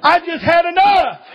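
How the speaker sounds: preaching at full force. Acted. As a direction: drive it home from the pulpit. Preaching climax.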